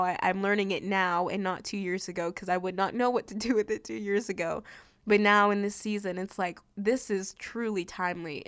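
usual speaking voice

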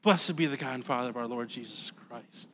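low, soft reading of Blessed; subdued recital. Shown as a demonstration. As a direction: say the praise quietly, Blessed unstressed. Repeats the verse, now subdued.

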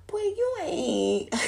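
exasperated but amused; retelling being mad, with a laugh; mock outrage. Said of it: "that shit pissed me off".

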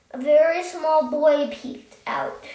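ordinary delivery

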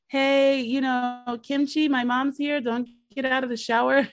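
hammed-up yell to warn his girlfriend; mock yelling. Son yells to Kimchi that mom is here, mock yell.

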